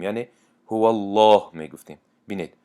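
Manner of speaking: ordinary talk, nothing marked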